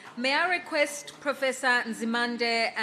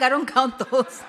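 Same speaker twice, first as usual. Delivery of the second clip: teasing, laughing aside; laughing mock quote; 4 semitones higher, 8 dB louder than usual. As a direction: poke fun while laughing. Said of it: "you're like".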